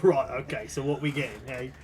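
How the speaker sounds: a showy Cockney accent, lightly put on; hamming up a Cockney bloke for a crowd; performative Cockney. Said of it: "you do the performative thing?".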